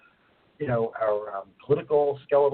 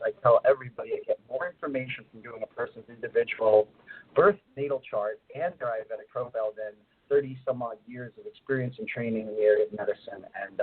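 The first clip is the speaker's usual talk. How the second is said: ordinary delivery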